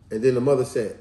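usual speaking voice

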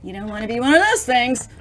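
usual speaking voice

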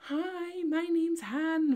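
high, loud, drawn-out mock greeting; put-on YouTuber intro voice. Vlogger sick of her old put-on intro, mockingly high and loud. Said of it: "sick of putting on this ... voice".

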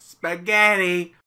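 loud slow shout. Acted. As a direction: belt the word out long.

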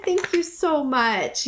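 usual speaking voice

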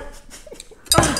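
high-pitched laugh through tears; giddy, teary laugh; high tearful laugh. Real, not acted. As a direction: laugh out, still choked up.

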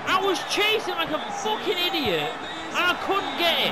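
loud, high frustrated shouting; angry shout. Real, not acted. Gamer furious at his own chase, angry shout. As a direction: yell the frustration out. Raging at the game.

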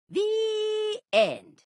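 high held sung "The end". Acted. Storyteller sings the closing "The end". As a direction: sing "The end" out on one high note.

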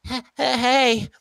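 high, unsure pony voice; timid Keldeo. Retold or imitated. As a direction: squeak it out like an insecure kid. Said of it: "the Keldeo one happens, and it's like".

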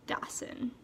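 usual speaking voice

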